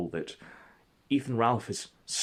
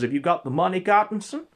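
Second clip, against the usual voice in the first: higher, louder tough-guy demand; demanding Ralph; 9 semitones higher, 6 dB louder than usual. Retold or imitated. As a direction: lean in and demand the cash.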